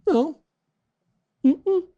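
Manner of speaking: sudden high squeaky denial. Shown as a demonstration. Liar asked about a woman there, high-pitched denial. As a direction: jump up into a high voice, acting a liar. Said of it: "ou fala mais agudo".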